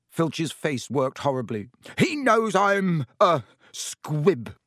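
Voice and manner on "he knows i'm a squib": higher, louder, slow and dragged out; forced confession. A narrator's reading. Caretaker finally blurting out his shameful secret, slowly. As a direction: force the word out at last. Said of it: "he finished".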